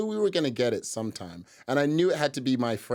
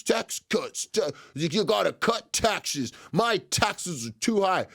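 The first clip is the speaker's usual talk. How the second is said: higher, slower sloganeering voter; mock tax-cut voter; 5 semitones higher, slower than usual. Host mocking voters with only vague slogans, mock-voter voice. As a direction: parody a complaining voter in a raised pitch.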